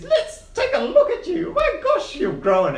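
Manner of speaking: put-on high, squeaky old gnome calling through a door; squeaky gnome behind the door. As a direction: pipe up high like a tiny old man. GM voices Quince.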